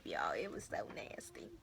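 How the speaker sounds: hushed, grossed-out whisper; disgusted whisper. Real, not acted. Telling viewers about her scab, disgusted whisper. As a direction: drop to a whisper as if it's too gross to say aloud.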